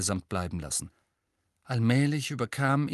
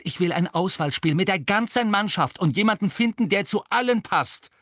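high, loud, rising fury; angry scolding; 10 semitones higher, 5 dB louder than usual. A narrator's reading. Angelina scolds Harry furiously. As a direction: lay into him.

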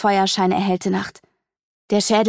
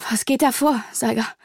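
anxious question; 4 semitones higher than usual. A narrator's reading. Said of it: "flackerte die Angst".

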